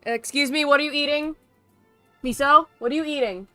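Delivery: ordinary delivery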